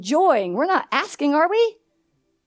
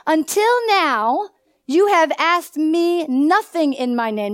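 slow, drawn-out Until now; slow preacher's emphasis; slow emphatic reading; 4 semitones higher, 4 dB louder, slower than usual. Acted. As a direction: stretch out the verse to make it sink in. Verse read slowly, emphatic.

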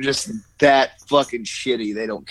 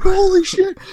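mock astonishment; 4 dB louder than usual. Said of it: "I was like".

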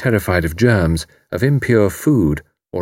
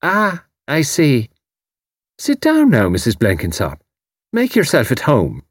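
Irish landlady; 5 semitones higher than usual. A narrator's reading. Mrs O'Rourke voiced.

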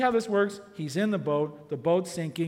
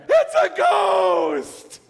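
very slow, loud shout; terrified shout; 2 semitones higher, 9 dB louder, much slower than usual. Retold or imitated. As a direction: shriek in fright. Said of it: "they freak out".

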